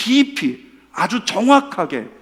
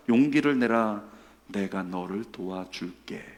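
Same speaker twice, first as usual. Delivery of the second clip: lower, softer and slow, gently comforting; God's tender voice; 6 semitones lower, 5 dB quieter than usual. Acted. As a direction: soothe him like a loving father. Reading God's tender words to deacon. (하나님이 철수에게 다정하게 위로하는 낮고 부드러운 목소리)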